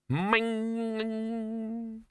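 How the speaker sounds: high ringing clang sound; ringing ming. A narrator's reading. Vase striking the bursar, a high ringing ming. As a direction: ring out like a struck vase. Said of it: "vase hits the bursar".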